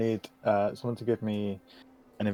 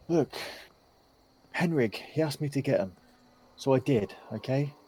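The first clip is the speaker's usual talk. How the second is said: put-on higher-pitched, defensive young man; nervous young suspect; 5 semitones higher than usual. Sweating suspect confesses to his questioners, nervous and defensive. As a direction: blurt excuses as a cornered young suspect, pitched up.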